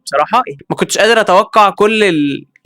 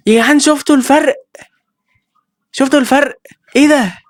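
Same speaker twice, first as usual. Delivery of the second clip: surprised; 8 semitones higher than usual. Real, not acted. Gamer shocked at the difference.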